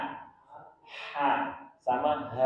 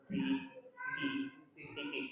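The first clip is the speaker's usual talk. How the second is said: ordinary talk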